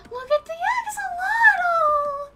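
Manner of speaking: very high, slow, loud squeal; adoring squeal. Real, not acted. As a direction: gush over something unbearably cute. Gushing over cute axolotl.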